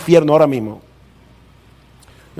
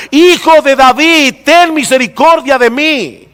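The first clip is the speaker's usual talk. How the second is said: high, loud, slow desperate cry; crying out even louder; 5 semitones higher than usual. Retold or imitated. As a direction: shout it higher and slower, pleading. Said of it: "comenzó a gritar mucho más".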